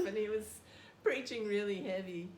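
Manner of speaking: ordinary talk, nothing marked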